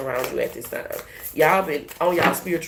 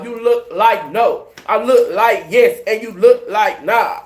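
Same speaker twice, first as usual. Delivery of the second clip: slow, louder teasing taunt; taunting singsong; 3 semitones higher, 6 dB louder, slower than usual. Pretend. Taunting haters, slow and louder. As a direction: taunt them, dragging each line.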